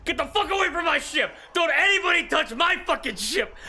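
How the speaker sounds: high furious shout at intruders; captain bellowing to protect his ship; angry yelling. A narrator's reading. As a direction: scream them off the ship. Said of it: "a familiar voice that is yelling".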